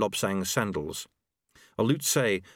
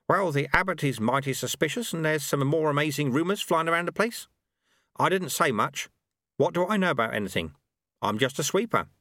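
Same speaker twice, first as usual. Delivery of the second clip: higher-pitched sweeper character voice; wry old sweeper; 6 semitones higher than usual. Acted. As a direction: play the humble sweeper passing on rumours.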